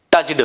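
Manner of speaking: touched with a voiced "duh" ending; wrong pronunciation. Shown as a demonstration. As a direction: say टच्ड as "tuch-duh". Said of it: "wrong pronunciation". (टच्ड के अंत में साफ़ ड)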